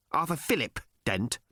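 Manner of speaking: brisk officious alien yap; efficient yap. A narrator's reading. Alien checking Arthur's name, efficient yap. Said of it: "pursued the alien in a kind of efficient yap".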